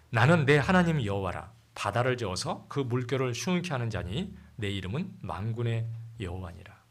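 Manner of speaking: loud, solemn Bible reading; scripture reading. Acted. Pastor reading the storm passage aloud, solemn and loud. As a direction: proclaim the verses to the congregation.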